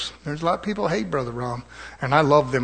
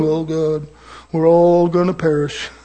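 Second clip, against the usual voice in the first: mock doom lament; 6 dB louder, slower than usual.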